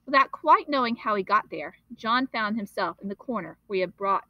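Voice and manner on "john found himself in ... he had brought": plain reading voice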